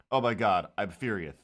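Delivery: usual speaking voice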